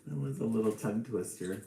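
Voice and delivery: usual speaking voice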